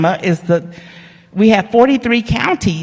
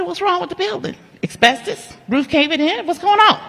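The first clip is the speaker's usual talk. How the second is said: high-pitched string of alarmed questions; shocked disbelief; 13 semitones higher than usual. Retold or imitated. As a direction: squeak out suspicious questions. Shocked at cheap price.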